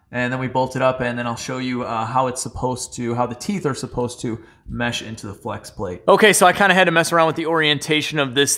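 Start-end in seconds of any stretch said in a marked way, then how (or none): none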